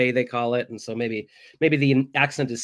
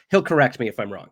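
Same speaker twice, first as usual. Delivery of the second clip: normal talking voice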